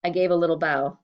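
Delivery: ordinary delivery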